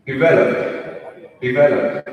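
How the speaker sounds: loud, stretched Indian-accented demo. Shown as a demonstration. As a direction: pronounce it in a strong Indian accent. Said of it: "stereotypical Indian accent".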